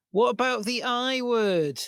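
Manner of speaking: imitated girlfriend. Clingy girlfriend to Bruce Wayne, imitated in a high slow voice. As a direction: raise the pitch and stretch each word. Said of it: "and she says".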